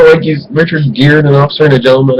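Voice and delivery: usual speaking voice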